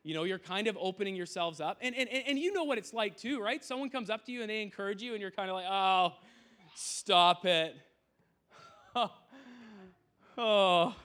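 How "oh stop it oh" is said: louder, bashful mock protest; flustered oh stop it. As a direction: brush off a compliment, embarrassed. Acting flattered embarrassed reaction.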